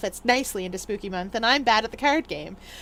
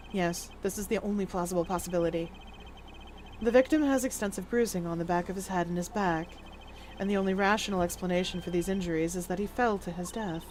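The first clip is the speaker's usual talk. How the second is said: low, soft, deliberate character voice; hushed, measured deduction; cool, composed investigator; 5 semitones lower, 8 dB quieter than usual. Acted. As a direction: reason it out quietly and formally.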